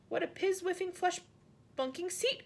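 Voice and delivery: high, very slow delighted giant; giant's cry. A narrator's reading. Giant delights in his seat on the piano, high and slow. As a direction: savour each odd word up high. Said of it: "cried the B F G".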